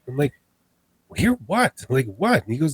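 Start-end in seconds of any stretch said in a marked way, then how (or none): 1.2-2.4 high, rising exasperated what; retold baffled retort, pitched way up; incredulous questioning. Hiker questions friend about a noise, incredulous.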